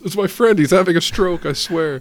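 high, loud frantic caller; hammed-up panic to the operator; panicked phone call. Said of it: "I gotta call nine one one".

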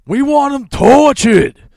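loud mock New York street rant; 1970s New Yorker on the news. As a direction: bellow it like an angry seventies New York crowd. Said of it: "every single clip is like".

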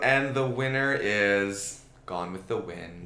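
slow, drawn-out winner announcement; suspenseful awards presenter. Pretend. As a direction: stretch it out for suspense. Announcing the Best Picture winner.

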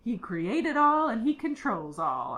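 chipper put-on accent, starting high and slow, then settling; hammed-up imitation in a terrible accent; Miss Minutes, bad accent. Podcaster imitates the character extolling her boss, chipper bad accent. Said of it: "sorry for my accent".

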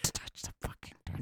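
whisper. Pretend. Whispered dare game.

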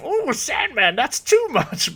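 high, loud comic impression; put-on catchphrase voice. Co-host riffing on an award upset, high hammed-up impression. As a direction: squeal it as a mock-overwhelmed catchphrase.

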